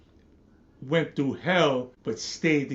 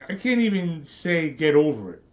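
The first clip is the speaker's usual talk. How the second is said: ordinary delivery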